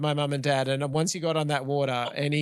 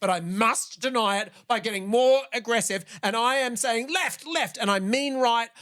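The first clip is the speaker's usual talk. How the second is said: mock angry dad; 6 semitones higher than usual. Mimicking her anxious dad steering the canoe, mock angry. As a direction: play the dad barking orders in the canoe. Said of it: "That's how it went".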